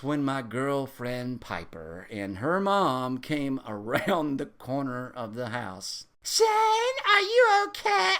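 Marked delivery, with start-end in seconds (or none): 6.2-8.1 high, slow, loud cry of alarm; screamed. Retold or imitated. Girlfriend finding Shane naked and painted, high scream. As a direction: scream out his name in alarm. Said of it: "she screamed".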